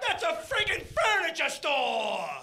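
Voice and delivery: loud, slow, high exasperated yell; mock outrage. Comedian ranting about meatballs at ikea, mock outraged. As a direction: draw it out in comic indignation.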